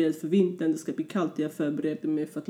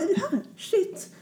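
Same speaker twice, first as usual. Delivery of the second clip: mock shocked "are you here", much higher, laughing; imitating surprised friends; 3 dB quieter than usual. Friends meeting him on the street, mock surprise, high and laughing. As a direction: play the friends' disbelief, pitched way up with a laugh.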